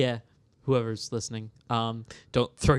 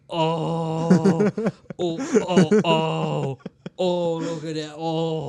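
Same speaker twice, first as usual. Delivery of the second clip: loud, higher, gushing "oh" noises; guttural cooing; 3 semitones higher, 6 dB louder, much slower than usual. Retold or imitated. Reacting to being shown a dog, guttural cooing. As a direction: imitate wordless gushing over a cute dog. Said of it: "I made such guttural sounds".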